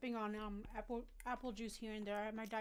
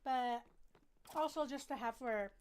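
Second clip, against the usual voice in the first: normal talking voice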